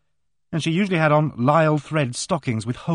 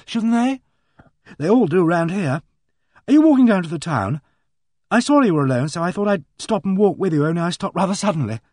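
put-on higher, brisk girlish voice, louder; eager young girl; 5 semitones higher, 5 dB louder than usual. A narrator's reading. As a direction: bounce along like an awkward young girl. Narrator voices Megan.

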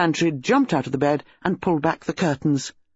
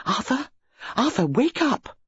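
urgent waking; 9 semitones higher than usual. Acted. Wife waking sleeping husband.

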